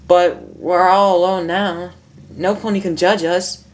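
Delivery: seductive sink voice. Acted.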